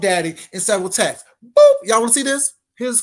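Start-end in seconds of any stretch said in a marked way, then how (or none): 1.4-1.7 a very high, loud squeak of a boop; high squeaky boop. Pretend. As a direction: pop it out like a cartoon sound effect. Sound effect after a reveal.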